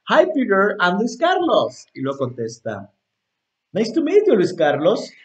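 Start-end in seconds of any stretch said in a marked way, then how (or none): 0.1-1.9 raised, loud introduction; first character. Acted. Teacher playing himself meeting Peter, high, loud greeting. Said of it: "Voy a hacer dos personajes". 3.7-5.1 high-pitched, loud reply; second character. Acted. Teacher playing Peter answering the introduction, high loud voice. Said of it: "Voy a hacer dos personajes".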